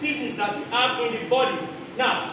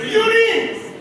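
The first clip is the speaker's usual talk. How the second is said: loud, high-pitched shout; shouted answer; 10 semitones higher, 6 dB louder than usual. Real, not acted. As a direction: call the answer out loudly. Class calls out the answer.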